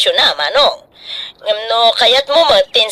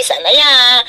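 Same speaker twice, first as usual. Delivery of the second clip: ordinary talk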